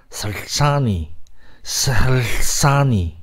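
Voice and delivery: 설사니 stretched very slowly, syllables apart; 설사니 sounded out at a crawl; slow sounding out. Shown as a demonstration. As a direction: drag out 설사니 like a model reading. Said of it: "이렇게 읽거든요".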